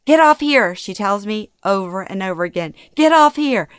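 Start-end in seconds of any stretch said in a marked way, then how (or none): 0.0-0.7 high, loud, urgent command; agitated mother. A narrator's reading. Anxious mother ordering daughter off the highway, agitated. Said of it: "Her anxiety rises by the moment". 3.0-3.7 high, loud, urgent command; agitated mother. A narrator's reading. Anxious mother ordering daughter off the highway, agitated. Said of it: "Her anxiety rises by the moment".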